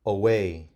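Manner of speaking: put-on teacher modelling away for kids; model word. Shown as a demonstration. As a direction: say it clearly for them to repeat. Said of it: "I'm going to say it, and then you can repeat after me".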